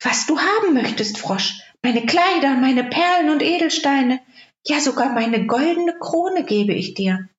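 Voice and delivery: put-on young princess. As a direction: offer him everything, high and eager. Princess answers frog. (hohe Prinzessinnenstimme)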